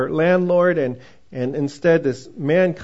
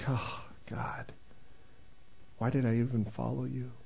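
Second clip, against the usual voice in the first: whispered, hushed complaint to God; discouraged believer whispering doubts to God; despairing whisper; 5 semitones lower, 15 dB quieter than usual. Retold or imitated. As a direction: breathe it out in defeat.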